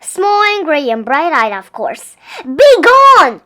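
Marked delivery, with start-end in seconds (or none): 2.4-3.4 big booming roar; loud furious bellow. A narrator's reading. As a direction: roar it out at the intruder. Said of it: "roared Bear".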